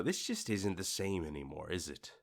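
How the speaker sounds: soft, let-down sigh of a line; dropping to a soft, let-down voice; disappointed murmur. Pretend. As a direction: quietly mourn the old burger. Imagined disappointed thought.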